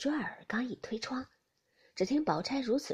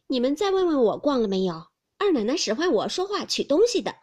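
much louder, firm; indignant defence; 3 semitones higher, 10 dB louder than usual. Acted. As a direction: challenge them to ask. Hongyu defending herself.

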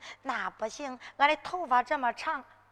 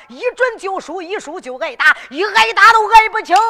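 rising alarm; 6 semitones higher, 15 dB louder than usual. Acted. Girl alarmed by losing lawsuit.

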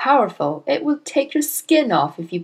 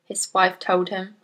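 usual speaking voice

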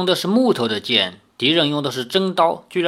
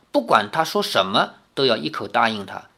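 ordinary delivery

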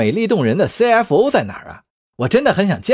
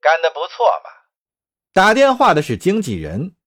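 a lightly voiced, coolly mocking caller; sly agent on the phone; 3 semitones lower, faster than usual. Agent taunts Otero on the phone, coolly mocking.

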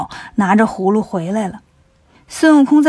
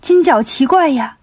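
plain reading voice

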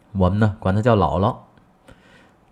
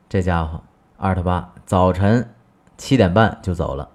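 ordinary talk, nothing marked

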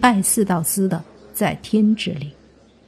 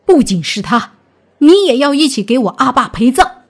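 loud, vengeful snarl; vicious threat; 5 semitones higher, 7 dB louder than usual. Acted. Grieving son threatening death for his father, vicious and loud. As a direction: threaten them hard, loud and rising. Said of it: "四道司的儿子狠狠地说道".

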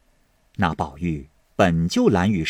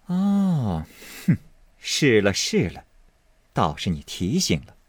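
amused realization; 2 semitones higher than usual. A narrator's reading. Fengjie catching on to her maid's hint, amused realization. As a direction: let the penny drop with a small scoff. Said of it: "笑道".

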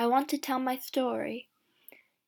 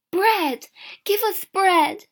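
higher, louder desperate cry; loud pleading cry; 9 semitones higher, 8 dB louder, slower than usual. Acted. Starving women crying out to Jeanette for bread, loud. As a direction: beg for food at full voice. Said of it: "they cry".

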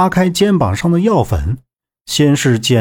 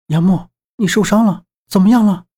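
higher-pitched, anxious question; alarmed concern; 6 semitones higher than usual. A narrator's reading. 夏洛伊 to wounded 杨木, alarmed concern. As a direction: ask in rising alarm. Worried friend rushes to injured man.

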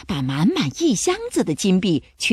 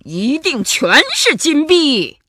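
excited shouting; 4 semitones higher, 6 dB louder than usual. A narrator's reading. Pirate captain to his crew at the treasure, excited shout. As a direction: crow loudly over the gold. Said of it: "头目汉克兴奋地嚷道".

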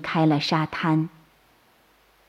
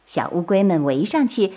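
ordinary delivery, nothing marked

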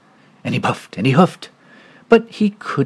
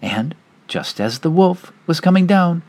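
usual speaking voice

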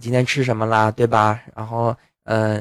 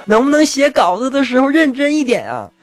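exasperated complaint; 9 semitones higher than usual. Real, not acted. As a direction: pitch jumps up high in exasperated protest.

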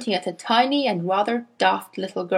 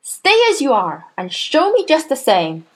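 louder, stern order; the Trunchbull; 5 semitones higher, 4 dB louder than usual. A narrator's reading. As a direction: command him to stay put. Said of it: "snapped the Trunchbull".

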